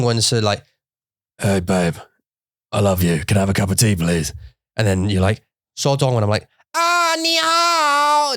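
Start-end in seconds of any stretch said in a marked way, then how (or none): 1.4-4.3 lower, relaxed English speaking voice; casual low voice asking her partner for tea; everyday English voice. Retold or imitated. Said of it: "my voice changes". 6.6-8.3 much higher, slow, sing-song 你好; switching to Chinese in a much higher voice. Retold or imitated. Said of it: "my voice changes".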